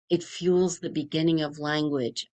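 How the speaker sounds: normal talking voice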